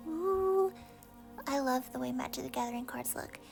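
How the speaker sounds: ordinary delivery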